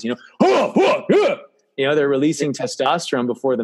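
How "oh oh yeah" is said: haka cry. Retold or imitated. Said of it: "the haka by the Maoris".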